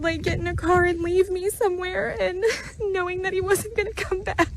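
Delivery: tearful, barely holding it together; crying. Real, not acted. Telling of dad leaving her, crying. Emotional vision story, breaking down.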